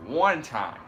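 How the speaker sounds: loud boxer catchphrase; Keith Thurman. Acted. Co-host quotes a boxer's catchphrase, loud imitation. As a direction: punch it out loud, mimicking a fighter. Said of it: "Keith Thurman's like".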